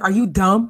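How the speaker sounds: imitating her, punch the word "dumb"; "dumb" hit hard, mimicking emphatic New York talk; hard stress on DUMB. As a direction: land "dumb" hard and loud. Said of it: "she says it real hard with dumb".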